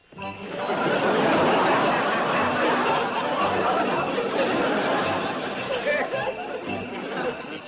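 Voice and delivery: long loud high belly laugh; big Santa laugh. Acted.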